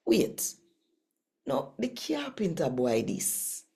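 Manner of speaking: lightly voiced Jamaican scripture read. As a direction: tell the Bible story plainly in patois.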